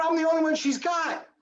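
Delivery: high, pressing protest to the doctor; desperate plea. Retold or imitated. Begging the doctor to stay.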